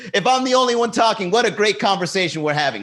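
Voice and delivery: high, loud, gloating cheer; mock delight. Host joking about talking alone, mock delight. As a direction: crow it with mock enthusiasm.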